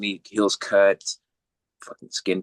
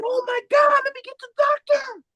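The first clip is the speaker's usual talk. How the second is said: very high-pitched mock panic; exaggerated squeaky nurse voice; shocked little nurse; 4 dB louder than usual. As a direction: imitate a nurse crying out in alarm. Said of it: "The little nurse came out".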